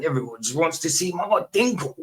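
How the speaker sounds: put-on a quick cheeky jab at his old comedy voice; a hint of a camp, teasing TV presenter; Russell Brand impression. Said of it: "He used to talk like that".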